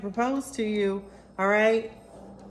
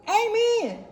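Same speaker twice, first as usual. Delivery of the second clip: high congregation-style call-out; mock church amen; 12 semitones higher, 4 dB louder than usual. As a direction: answer like a playful one-woman congregation. Answers own call for an amen.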